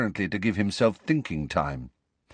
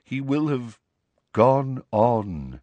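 solemn; 2 semitones higher, 3 dB louder, slower than usual. A narrator's reading. Nick solemnly explains death.

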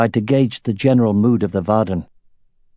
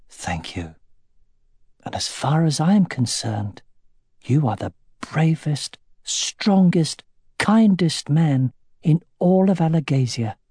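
a lightly higher, earnest wife's voice; Katrina, tender and firm; 6 semitones higher than usual. Acted. Wife reassuring her ashamed husband, tender and firm. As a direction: reassure him with gentle conviction.

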